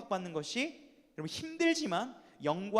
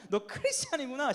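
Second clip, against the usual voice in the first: higher-pitched knowing jeer; mocking tone; 5 semitones higher than usual. Shown as a demonstration. Preacher showing friends the right way to taunt, mocking. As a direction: taunt them with mock discovery. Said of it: "이게 아니라".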